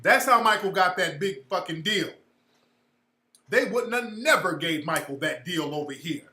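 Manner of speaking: normal talking voice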